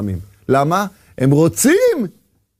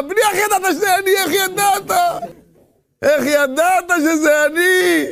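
high amazed question; a touch of a surprised father; 6 semitones higher than usual. Acted. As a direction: ask in playful surprise.

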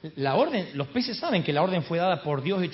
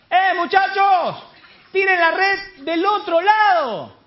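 high, long-drawn holler; calling shout; 12 semitones higher, 6 dB louder, slower than usual. Acted. Man on the shore calling to fishermen at sea, drawn-out shout. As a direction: project slowly across the water, stretching the vowels. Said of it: "¿Quién es que grita ya?".

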